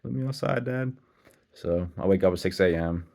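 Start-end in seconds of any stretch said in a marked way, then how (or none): 0.0-1.0 high whiny puppy voice, pitched way up; voicing the puppy. The puppy begging Dad to be let outside, high pleading voice. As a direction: plead like a little dog wanting out. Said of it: "She's like".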